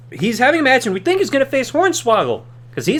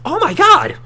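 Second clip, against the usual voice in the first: mock amazement; 10 semitones higher, 4 dB louder than usual. Exclaims after gasp.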